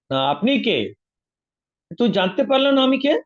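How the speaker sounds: high and loud, demanding to be known; a forest man thundering who he is; mock outraged demand; indignant challenge. Angry figure demands recognition.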